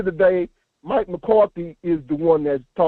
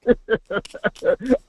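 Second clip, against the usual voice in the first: real laugh; 4 semitones higher than usual. Real, not acted. Caller still laughing.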